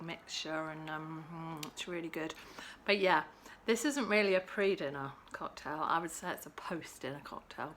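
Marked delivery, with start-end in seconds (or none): 5.8-7.7 whisper. Real, not acted. Confiding aside.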